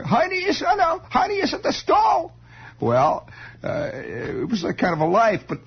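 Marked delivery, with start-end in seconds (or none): 0.0-2.3 put-on very high, German-accented reply; mother calling back. Mother answering from the back porch, high German-accented call. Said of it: "you would hear in the back there".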